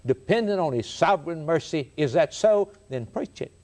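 emphatic pulpit cadence with ringing rhetorical questions; impassioned preaching. Real, not acted. Preacher urging fellow preachers to preach it, fervent. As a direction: drive each point home to the congregation.

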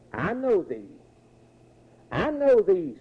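demon's cry, lightly acted. Demon recognizes Jesus in the synagogue, mildly acted cry. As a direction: lift the pitch, then let it fall. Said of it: "the unclean spirits are crying out".

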